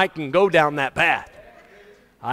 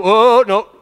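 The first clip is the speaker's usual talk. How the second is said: loud, higher wail of mock alarm; mock dismay; 5 semitones higher, 10 dB louder than usual. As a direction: play an alarmed churchgoer. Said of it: "some of you go".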